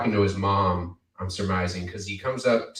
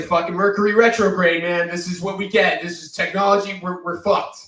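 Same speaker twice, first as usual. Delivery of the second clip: higher, louder mock-despairing boss; hammed-up doom boss; 9 semitones higher, 7 dB louder than usual. Boss blaming broken machines on Mercury retrograde, mock despair. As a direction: ham up the boss blaming the stars. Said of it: "And he's just like".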